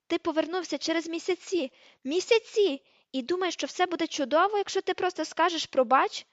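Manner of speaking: high-pitched, incredulous outrage; indignant tirade. A narrator's reading. Hermione scorning Ron's apology, indignant.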